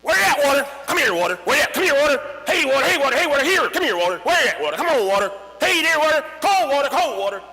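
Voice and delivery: high, loud singsong calling; calling the water. Retold or imitated. Kriegel calling to the water with the stick. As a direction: coax the water like a pet.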